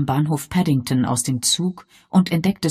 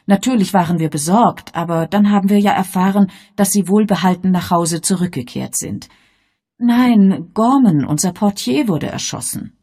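higher, louder fussing receptionist; eager receptionist; 4 semitones higher, 6 dB louder than usual. Acted. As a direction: welcome him with busy eagerness. Miss Gorringe voiced in story.

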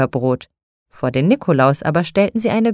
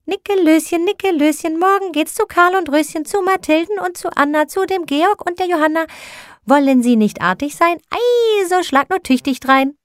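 much higher, sing-song teasing chant; tiny angels mocking in a shrill chorus; high teasing little-angel voices; shrieking angels; 11 semitones higher than usual. A narrator's reading.

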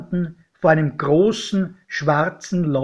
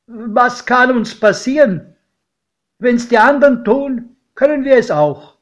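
higher, louder defiant call; 7 semitones higher, 6 dB louder than usual. A narrator's reading. Godfather in the hayloft, calling out boldly. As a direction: rouse yourself with bold resolve. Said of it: "da rief mein Pate".

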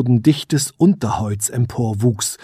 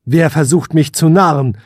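louder and higher challenge; calling into the dark; 4 semitones higher, 6 dB louder than usual. Acted. Prince calls into the darkness at glowing eyes, loud and high. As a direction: challenge the unseen foe. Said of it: "rief der Prinz in die Dunkelheit hinein".

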